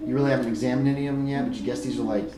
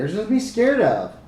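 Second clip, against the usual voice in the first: put-on high, louder child's voice; little-girl voice, pitched right up; little girl character; 8 semitones higher, 5 dB louder than usual. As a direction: insist like a fearless small kid. Game master answers as little girl.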